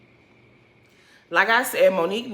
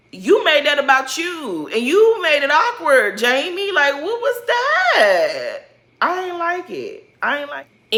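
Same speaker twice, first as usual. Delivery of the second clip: raised, slow, fed-up scolding; exasperated scolding; 7 semitones higher, 4 dB louder, slower than usual. Real, not acted. Scolding Jamie to the audience, exasperated and raised. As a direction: call him out, higher and louder.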